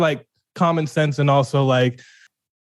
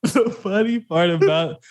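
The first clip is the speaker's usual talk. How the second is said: higher, laughing attempt to speak; helpless laughter; 6 semitones higher than usual. Real, not acted. Host trying to explain the funny part, breaking into laughter. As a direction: start the sentence and crack up. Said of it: "we need to take a break".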